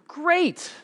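a leap up into a high, gushing exclamation. Retold or imitated. Joke preacher delighted with the stranger's answer, high and gushing. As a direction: sound thrilled, way up high.

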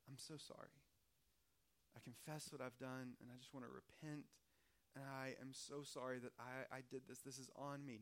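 low, hushed, remorseful apology; whisper-soft, sorry and sincere-sounding; mock contrite apology. Pastor mocks Eve owning up, low and soft. Imagined ideal repentant Eve.

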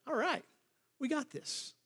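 high, soft, smug little aside; acted-out cocky confidence; self-satisfied. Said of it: "they're feeling pretty good about themselves".

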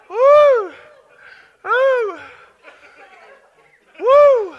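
loud, high woo; joyful whoop. Real, not acted. Preacher caught up in holy laughter, high joyful whoop. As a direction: whoop with joy.

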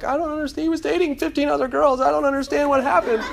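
high, mock-weepy whine; mock crying contestant. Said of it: "and they're all crying".

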